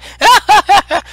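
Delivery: high, loud manic cackle; mad laughter. Acted. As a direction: cackle like a madman. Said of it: "laughing madly, just like".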